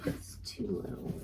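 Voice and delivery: muttered to herself, very soft; quiet aside. Real, not acted. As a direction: say it under your breath.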